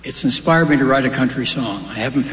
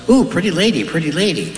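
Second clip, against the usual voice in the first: high, swooping parrot squawk-talk; 4 semitones higher than usual. Acted. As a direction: coo it like an admiring pet parrot. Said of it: "The parrot goes".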